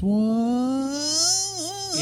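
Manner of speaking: high sliding whoa like a bent string; bent guitar note. Shown as a demonstration. Guitarist demonstrating a pitch bend with a high sliding whoa. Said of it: "so it goes".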